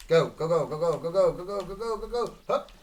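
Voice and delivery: loud, high rapid urging; urgent go go. Real, not acted. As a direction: chant go at speed. Urging during the mission.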